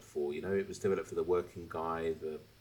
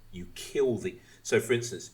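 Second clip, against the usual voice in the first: ordinary talk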